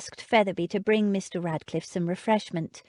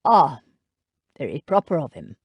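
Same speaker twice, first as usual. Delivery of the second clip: lower, strangled and quick; 4 semitones lower, 5 dB louder, faster than usual. A narrator's reading. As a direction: choke the words out. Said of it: "said in a strangled voice".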